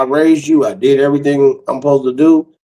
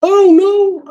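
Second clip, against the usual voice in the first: high, loud mock-scared squeal; scared son; 4 dB louder than usual. Son scared to buy a gun, high mock-scared yelp. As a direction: yelp it like a frightened kid. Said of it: "He was scared, too".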